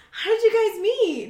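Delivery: high, chirpy mock-curious friend; imitating curious friends. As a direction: ask like a nosy friend in a bright lifted voice.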